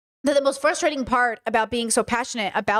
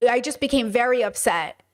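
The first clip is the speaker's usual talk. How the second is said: ordinary talk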